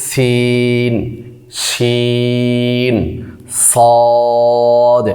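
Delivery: সিন, শিন, সদ each held long over three alif counts; three-alif stretch. Shown as a demonstration. Teacher demonstrates the long stretch to students, slow and held. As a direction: draw out সিন, শিন, সদ slowly in recitation style. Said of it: "তিন আলিফ টান দিতে হবে".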